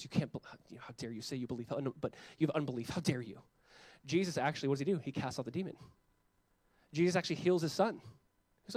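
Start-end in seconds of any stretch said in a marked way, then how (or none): 0.0-3.4 soft, low imagined rebuke; hushed mock scolding. Imagined Jesus rebuking the doubter, hushed mock scolding. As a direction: scold under your breath. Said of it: "I love he goes".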